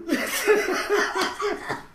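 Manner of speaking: high, helpless laughing fit; squealing real laughter; tearful laughter. Real, not acted. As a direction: laugh until you cry. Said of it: "I'm actually crying".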